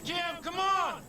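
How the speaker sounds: calling out. Acted. Husband to wife, calling out loudly. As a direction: call out across the yard.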